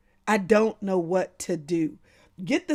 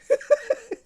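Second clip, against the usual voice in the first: a high, bright burst of laughter; 4 dB louder than usual. Real, not acted. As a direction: laugh at a friend's comment. Laughing at Marianne's comment.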